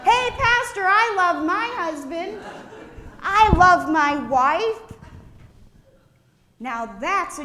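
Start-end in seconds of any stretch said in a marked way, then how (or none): 0.0-4.9 high, loud playful call-outs; shouted out. Retold or imitated. Congregants calling out love to the pastor, loud playful shouts. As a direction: shout it to the pastor like a happy interruption.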